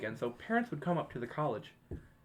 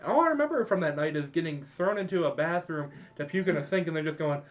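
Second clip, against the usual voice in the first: ordinary talk, nothing marked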